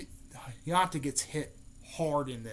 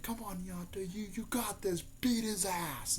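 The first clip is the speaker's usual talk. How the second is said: higher-pitched, urging cheer; mock cheering; 6 semitones higher, 4 dB quieter than usual. Naruto rooting for Nyata in her fight, mock cheering. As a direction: cheer them on in a higher put-on voice.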